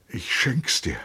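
high, tender offer; deeply moved. A narrator's reading. Lieutenant offers his horse to his friend, deeply moved. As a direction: give it away, touched and high-voiced. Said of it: "sagte Trotta gerührt".

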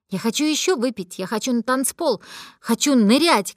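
put-on loud drunken demands, rising to a yell; drunk shouting. Drunk girl yells at her friend holding her back, loud. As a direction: shout it like a tipsy girl fighting to get loose. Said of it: "кричала я и пыталась вырваться".

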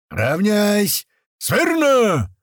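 high, loud drill shout with drawn-out words; barked parade command. A narrator's reading. Tuman calls the fighters to attention, barked command. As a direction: bellow it like an officer on parade. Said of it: "рявкнул Туман".

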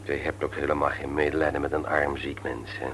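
low, soft self-pitying complaint; sick husband begging his wife for pity; self-pitying whine. Acted.

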